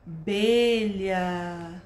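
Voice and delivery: "abelha" very slow, held initial A; stretched first sound. Shown as a demonstration. Teacher showing the first sound, "abelha" with long A. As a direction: draw out the A at the start of "abelha". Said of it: "Viu que o início eu foquei no som?".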